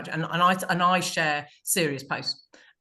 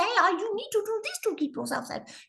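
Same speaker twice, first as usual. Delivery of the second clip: high, squeaky cat voice, pitched way up. Shown as a demonstration. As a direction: give the cat a little high voice. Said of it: "there are ways you can give the cat a voice".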